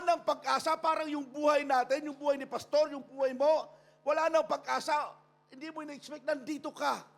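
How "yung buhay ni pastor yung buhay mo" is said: normal talking voice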